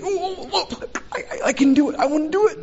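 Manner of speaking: very high, stammering eager squeal; excited Peter. Acted. Keen disciple volunteering to walk to the Lord, hammed-up excitement. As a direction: act out bursting, can't-wait eagerness, laughing. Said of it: "You know, he's excited".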